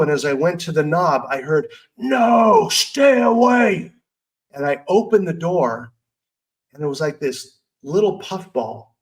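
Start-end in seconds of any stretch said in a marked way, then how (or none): 2.0-3.9 high, loud monster shout, slow on stay away; lightly hammed-up dragon warning; booming dragon voice. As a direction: warn her off like a dragon guarding its lair. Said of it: "big, booming, dragon-like voice".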